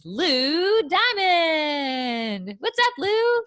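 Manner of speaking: big drawn-out announcer intro; game show host. Acted. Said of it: "It sounds like a game show host".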